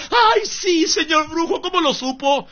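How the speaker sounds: high, mocking falsetto of a gullible woman. Preacher mocking a woman answering the witch doctor, high falsetto. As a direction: squeak it out like a naive client.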